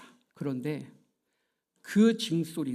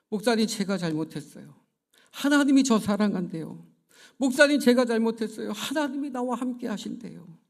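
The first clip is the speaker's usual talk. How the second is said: higher, louder pleading to the pastor; tearful confession; 5 semitones higher, 6 dB louder than usual. Retold or imitated. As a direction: blurt it out, overwhelmed. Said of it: "막 울면서 그런 고백을".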